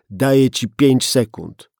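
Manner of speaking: loud, hard; countdown threat. Acted. Gunman interrupting the policeman with a loud five-second threat. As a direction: cut him off with the threat.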